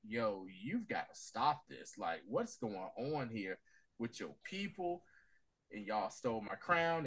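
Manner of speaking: queen's hushed accusation, slow and low. Retold or imitated. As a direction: lay the accusation down quietly and slowly.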